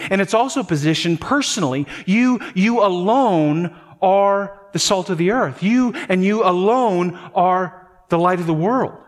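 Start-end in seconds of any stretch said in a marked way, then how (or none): 2.0-8.9 slow, weighty "You, you alone"; emphatic you. Shown as a demonstration. Pastor drives home to the church that they alone are called, slow and emphatic. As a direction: press hard on "you alone", slowly. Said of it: "positioned emphatically".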